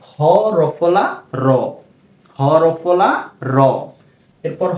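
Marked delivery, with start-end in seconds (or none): 1.4-1.5 র said alone, the হ silent before it; correct reading. Shown as a demonstration. Teacher models the right sound for students. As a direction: say only র, no হ sound at the front. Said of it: "তখন এর উচ্চারণটা হবে".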